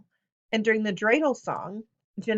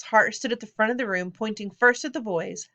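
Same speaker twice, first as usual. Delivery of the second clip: plain reading voice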